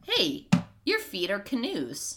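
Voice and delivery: high, drawn-out goofy announcement; playful put-on character quote; Reepicheep. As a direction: swoop up on a slow, silly observation. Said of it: "Reepicheep is just like".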